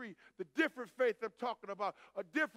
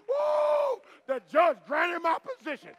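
high loud whoop of triumph; overjoyed retold shout; shouting for joy; 8 semitones higher, 12 dB louder, slower than usual. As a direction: shout the good news down the phone. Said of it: "called me on the phone shouting".

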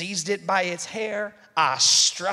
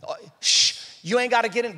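ordinary delivery

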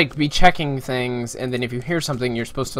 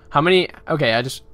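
ordinary talk, nothing marked

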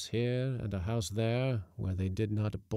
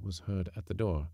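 usual speaking voice

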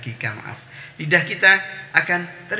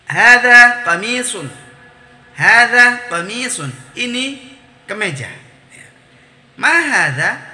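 higher, slow, melodic teacher recitation; question-and-answer drill; 7 semitones higher, 7 dB louder than usual. Shown as a demonstration. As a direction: model each phrase with strong questioning and answering tunes.

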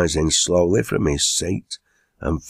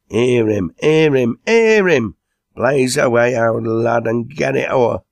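building to a high, loud cheer; rousing heckle; 6 semitones higher, 6 dB louder than usual. A narrator's reading. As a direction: egg the speaker on, louder each time.

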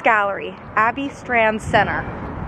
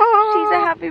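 excited; 10 semitones higher, 3 dB louder than usual. Real, not acted. Cooing at baby.

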